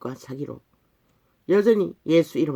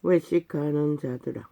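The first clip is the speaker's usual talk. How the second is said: scripture recitation; 2 semitones lower, 5 dB quieter, slower than usual. Acted. Preacher reading Isaiah's rebuke of hypocrites, slow solemn recitation. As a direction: intone the verse slowly, word by word.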